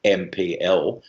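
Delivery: ordinary talk, nothing marked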